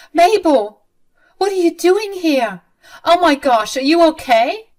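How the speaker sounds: high, loud startled alarm; shocked concern. Acted. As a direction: gasp out the questions in alarm. Violet finds Mabel locked in.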